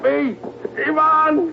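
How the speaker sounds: a frantic shout of the name; desperate cry. Acted. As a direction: scream his name in panic.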